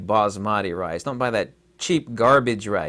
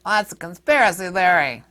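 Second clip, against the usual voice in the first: high-pitched mock dismissal; mocking doubters; 7 semitones higher, 3 dB louder than usual. Host mimicking everyone who dismissed the warning, mocking doubters. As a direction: parrot the scoffers in a put-on higher voice. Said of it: "when everybody was saying".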